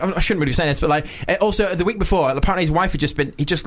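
ordinary talk

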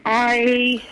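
ordinary talk

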